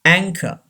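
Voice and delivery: anchor as "ANG-ka", no R at the end; anchor, stressed first, ending in "ka"; silent final R. Shown as a demonstration. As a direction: say anchor with CH as k and drop the R. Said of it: "R is not pronounced".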